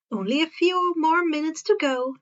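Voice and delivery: usual speaking voice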